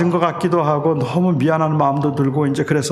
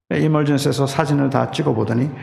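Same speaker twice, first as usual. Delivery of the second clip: ordinary talk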